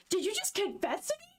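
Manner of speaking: high-pitched, incredulous question; acted astonishment. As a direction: ask it as if floored. Acted shock at apparent confession.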